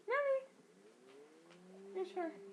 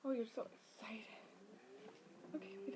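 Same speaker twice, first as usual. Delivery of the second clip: whisper; 9 semitones lower, 13 dB quieter, faster than usual. Real, not acted. Soft aside to the baby.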